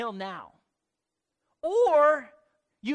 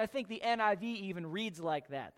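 ordinary talk

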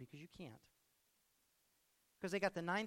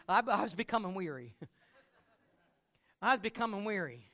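laughing; 8 dB louder than usual. Real, not acted. Laughs while repeating line.

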